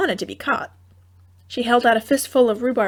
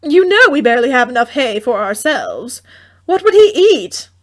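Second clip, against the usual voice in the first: worried mother; 7 dB louder than usual. A narrator's reading. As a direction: fret about the hay.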